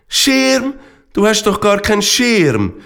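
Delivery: high-pitched, incredulous cry; astonished call. A narrator's reading. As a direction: call out in disbelief. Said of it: "rief der Fralpi".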